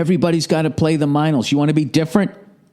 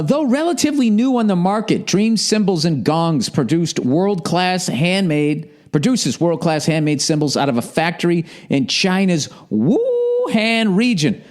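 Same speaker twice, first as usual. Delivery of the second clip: higher, slow mock commercial read; higher hammed-up advertisement reading; 5 semitones higher than usual. Shown as a demonstration. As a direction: read the copy like a pitchman. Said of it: "start the advertising with a free read".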